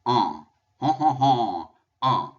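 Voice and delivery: "uh" pushed up through the nose, repeated; nasal uh drill. Shown as a demonstration. Teacher to students, drilling the nasal "uh" loudly. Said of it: "push it out of your nose".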